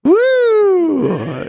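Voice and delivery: high, drawn-out woo; cheering "woo" that slides down at the end; whoop. Real, not acted. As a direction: let out a long high-pitched whoop. Cheering an answer from congregation.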